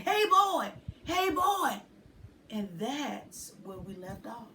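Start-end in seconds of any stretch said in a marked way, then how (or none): 0.0-1.8 put-on higher, louder girl calling out. A narrator's reading. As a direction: wake him up with a sharp girl's call. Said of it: "standing over him with wild hair saying".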